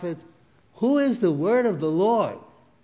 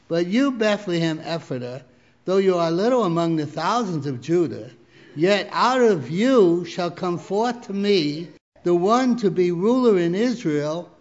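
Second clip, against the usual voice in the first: rising, louder proclamation; building scripture reading; 4 dB louder than usual. Acted. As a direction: build the verse to a peak. Reads Micah, building.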